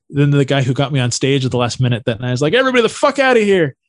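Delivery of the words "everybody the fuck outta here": high, fast order to clear out; mock-yell to empty the room in a hurry; imitated urgent yell. Host clearing room during brawl.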